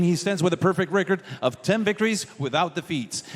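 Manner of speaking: normal talking voice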